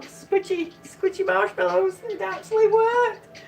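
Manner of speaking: high-pitched, giddy delight; real excitement. Real, not acted. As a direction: marvel happily that it worked. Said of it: "this is so exciting".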